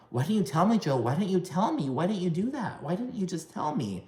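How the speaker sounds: high, softened, repetitive pleading in imitation; mock badgering. Acts Teresa badgering Joe with repeats.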